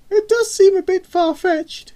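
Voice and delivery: very high, loud mum voice; mother's voice. A narrator's reading. Mother relaying news to her daughter, high and loud.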